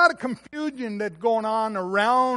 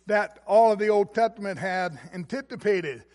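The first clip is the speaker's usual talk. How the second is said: ordinary talk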